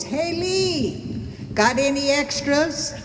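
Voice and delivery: put-on high singsong call of the beach boys. Beach boys asking Lee for spare food, high imitated call. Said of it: "And all the beach boys".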